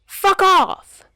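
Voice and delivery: high, distant spat retort; faint retort. Acted. Bomber answering faintly from a distance. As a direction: fling it back from afar. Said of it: "a faint but potent".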